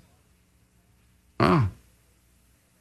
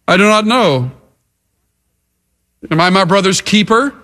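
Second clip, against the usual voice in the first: mildly defiant retort, a little raised; 3 semitones higher, faster than usual. Acted. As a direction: answer back like an acted rebel. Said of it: "you will see in living color defiance and rebellion".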